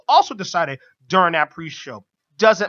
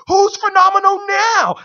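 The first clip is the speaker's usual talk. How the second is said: high, loud screamed taunt; shrill mocking yell; 14 semitones higher, 8 dB louder than usual. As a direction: scream it like a gloating villain. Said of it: "This Joker screamed".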